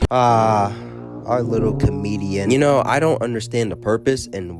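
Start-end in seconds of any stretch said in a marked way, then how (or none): none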